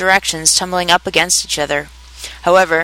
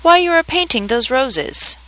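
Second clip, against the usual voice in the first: slightly timid, high and slow question; 7 semitones higher than usual. A narrator's reading. Alice asking the gardeners about the roses, a little timidly. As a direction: ask it a little shyly, pitched up and drawn out. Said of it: "a little timidly".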